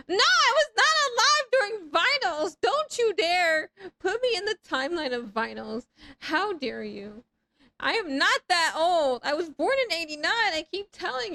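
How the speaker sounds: high, loud outraged protest; mock indignation. Streamer to chat about vinyls, high loud mock outrage. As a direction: act insulted to the bone. Denying being old to chat.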